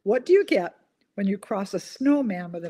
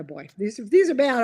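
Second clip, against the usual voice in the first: ordinary talk, nothing marked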